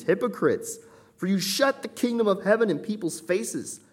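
high, loud, ringing condemnation; scathing rebuke. Acted. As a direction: thunder the rebuke at the hypocrites. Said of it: "it is a scathing chapter of rebuke".

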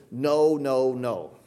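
mock-literal triple no; repeated no. Shown as a demonstration. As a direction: hammer each "no". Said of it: "woodenly translated".

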